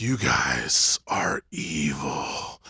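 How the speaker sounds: low, slow grudging verdict; defeated slow verdict; mock dismay. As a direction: drag it out defeated. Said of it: "I was like".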